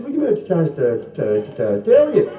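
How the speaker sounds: comic stutter; stammering punter. Acted.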